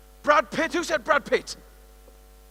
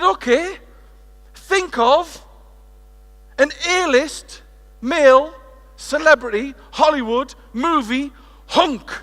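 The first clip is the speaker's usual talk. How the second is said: louder and higher, listing slowly; excited build-up; 5 semitones higher, 7 dB louder, slower than usual. Acted. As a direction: hype up the crowd. Teasing build-up for the audience.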